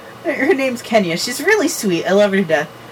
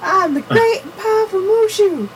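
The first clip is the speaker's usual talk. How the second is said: cartoon dragon voice; 12 semitones higher than usual. Movie quote impression.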